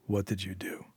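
quiet, low threatening growl. A narrator's reading. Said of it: "Syl growled".

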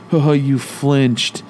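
very slow, sneering retold taunt; mocking taunt. Kid sneering at dad who flinched, slow mocking drawl. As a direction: stretch it out like a smug kid. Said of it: "And he's like".

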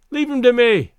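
high, loud call; eager cry. A narrator's reading. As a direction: cry it out to the whole group. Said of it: "cried Bobby Kane".

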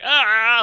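imitated sob. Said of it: "just crying".

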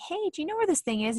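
high, quick, casual question; innocent asker. Acted. Voices someone asking innocently.

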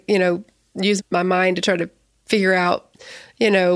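usual speaking voice

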